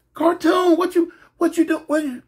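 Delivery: high, startled woman; shocked yelling. Retold or imitated. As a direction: call out in shock. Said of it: "her sister ended up coming in".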